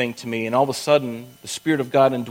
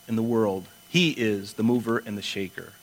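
ordinary delivery

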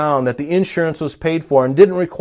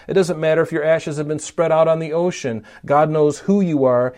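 ordinary talk, nothing marked

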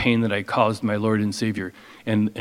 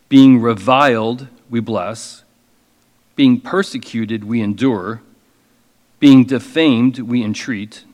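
slow, emphatic, louder scripture reading; preaching; 5 dB louder, slower than usual. Acted. Preacher reading Paul's hardships to the congregation. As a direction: proclaim each hardship with weight.

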